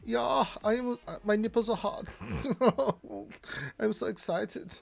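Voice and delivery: high, excited mock German voice; German accent. Host fawns over the German viewer's arrival, giddy German accent. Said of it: "Our most famous German".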